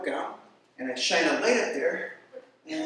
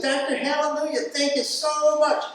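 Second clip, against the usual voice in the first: higher, louder, joyful woman's voice; grateful patient; 8 semitones higher, 8 dB louder than usual. Retold or imitated. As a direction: gush thanks like the relieved mother. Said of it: "She sat up and said".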